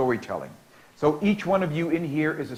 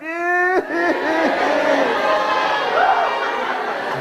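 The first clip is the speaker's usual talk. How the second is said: high hammed-up wailing cry, much higher; mock crying wail; 14 semitones higher, 3 dB louder than usual. Shown as a demonstration. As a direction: wail like a crying kid for the class. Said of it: "I want you to cry".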